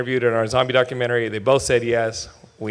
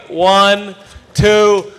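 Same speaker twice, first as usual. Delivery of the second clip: calling out; 6 semitones higher, 7 dB louder, much slower than usual. Real, not acted. Host counts crowd in loudly.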